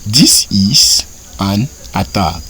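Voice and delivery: slow, deliberate reading; careful drawn-out teacher delivery; slow model sentence. Shown as a demonstration. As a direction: say it slowly for learners to repeat. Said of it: "Pour dire ceci est une attaque".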